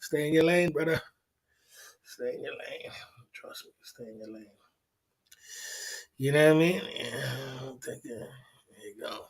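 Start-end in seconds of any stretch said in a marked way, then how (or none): 2.0-2.9 whispered, much softer and lower. Shown as a demonstration. Whispering the warning to the viewer. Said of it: "whispered repeat". 3.3-4.5 hushed, menacing whisper. Real, not acted. Whispering a warning to a disrespectful viewer. 7.0-9.2 lower, much softer murmur; hushed. Real, not acted. Softly murmuring as he takes a drink.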